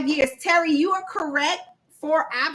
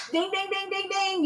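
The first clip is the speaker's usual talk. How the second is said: game-show ding; 5 semitones higher, 5 dB quieter than usual. Pretend. Game-show correct answer chime.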